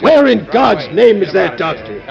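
high, loud urgent orders; frantic shouting. Acted. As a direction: bark orders over the crowd. Aide shouting orders in chaos.

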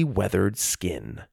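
ordinary delivery

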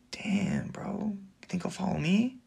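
unvoiced awed whisper; breathy hushed wonder. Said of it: "people will be like".

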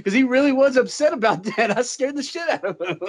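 words riding on giggles; laughing while talking. Real, not acted. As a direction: tell it through your laughter. Laughing through own funny story.

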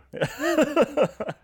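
a quick, high burst of laughter; high-pitched laugh. Real, not acted. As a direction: laugh lightly at your own admission. Laughs about buying burritos.

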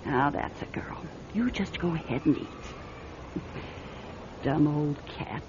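unvoiced coaxing murmur; soft whisper to the cat. Fortune teller whispering to her cat, tender coaxing. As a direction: hush and coax the cat to eat.

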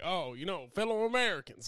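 lightly voiced presidential address; a hint of a president greeting the nation; Bill Clinton impression. Stand-up bit for three people, light president voice. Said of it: "Bill Clinton, but the good Bill Clinton".

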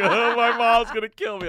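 high, loud panicked whine through laughter; mock panic. Mocking a panicked friend in the cop car, high and laughing. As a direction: play the scared kid who fears his mom.

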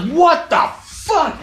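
high, slow, stretched-out disbelief; stunned outrage. Retold or imitated. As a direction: draw out a mock-shocked reaction to a blow. Retells reaction to being hit.

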